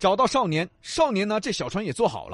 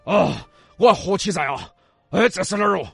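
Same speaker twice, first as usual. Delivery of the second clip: dazed castaway merchant; 6 dB louder than usual. Acted. Shipwrecked merchant wakes on shore.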